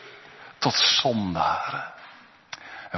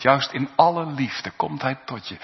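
normal talking voice

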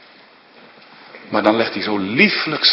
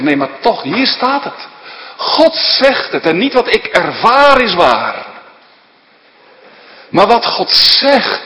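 preaching at full force; 10 semitones higher, 5 dB louder than usual. Acted. Preacher calling the church in Waarder to Christ, loud and high. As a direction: ring out the gospel call over the congregation. Preacher proclaims God's word.

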